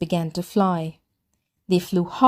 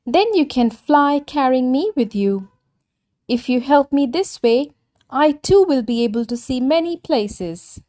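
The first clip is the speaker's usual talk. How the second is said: higher, eager little tortoise explaining his plan; 5 semitones higher than usual. Acted. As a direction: pitch up as the chatty tortoise.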